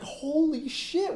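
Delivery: slow, high, astonished exclaim; freaking out. Retold or imitated. Retelling the family's reaction to the effects, high and drawn-out. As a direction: stretch it out in amazed disbelief. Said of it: "we were all freaking out".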